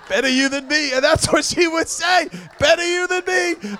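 high, loud imitation of the blanket lady; gleeful mock shout. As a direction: yell it out, hamming up her line. Lady throwing blankets, repeated louder.

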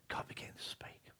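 hushed whisper. Real, not acted. Pastor telling how God finally spoke, whispered. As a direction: breathe it out in awe.

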